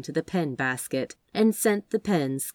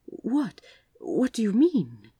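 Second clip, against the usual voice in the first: alarmed surprise; 5 semitones higher, slower than usual. Acted. Aziraphale alarmed by confession.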